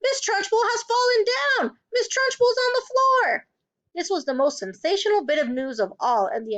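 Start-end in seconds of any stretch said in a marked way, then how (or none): 0.0-3.5 very high, sustained schoolboy scream; shrill, excited alarm. A narrator's reading. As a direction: shout the sensational news to the class.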